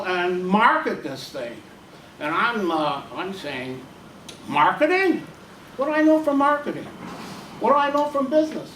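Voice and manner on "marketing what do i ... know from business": high, fast, exasperated protest; flustered protest. Retold or imitated. Milt protests to Harriet that he knows no business, high and fast. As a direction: rattle it off, throwing up his hands.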